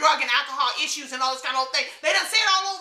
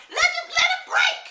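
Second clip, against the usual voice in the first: much higher, slowed, fed-up cry; exasperated outburst. Real, not acted. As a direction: throw it up high in disbelief. Angry outburst at a man's complaint.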